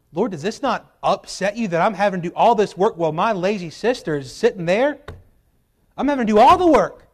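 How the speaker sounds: building from a grumble to a loud, high whine; exasperated complaint. Retold or imitated.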